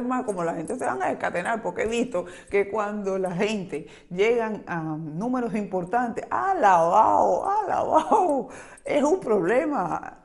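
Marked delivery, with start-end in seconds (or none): 6.3-10.2 loud, drawn-out, wailing "alabao" going up high; a slow, mock-anguished cry of alabao; dramatic exclamation. Real, not acted. Vlogger wailing about harsh online critics, loud and drawn out.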